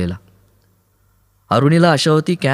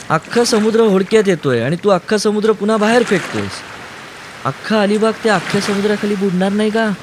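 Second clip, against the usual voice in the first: put-on a girl joking through fear; lightly voiced young woman; 10 semitones higher than usual. A narrator's reading. Kaumudi teases Aruni while he bails.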